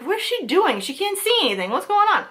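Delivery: high, baffled questions at the dark; asking herself what her sister is doing; puzzled alarm. Retold or imitated. Retelling her confusion as her sister walks in the dark, pitched high. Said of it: "And I was like".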